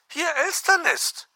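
very high bird voice; chattering magpie. Acted. Magpie greets on the phone, very high bird voice. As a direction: chirp it like a magpie.

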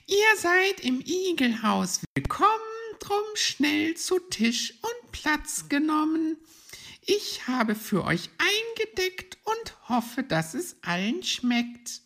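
very high, loud rhyming welcome; high-pitched Mrs. Hedgehog reciting to her guests; hostess hedgehog. Acted. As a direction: squeak out a welcome in verse. Frau Igel welcomes guests in rhyme.

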